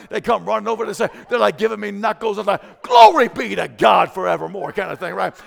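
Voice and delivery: loud, high, rising sermon climax; full-force preaching. Acted. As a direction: preach it out, shouting to the church.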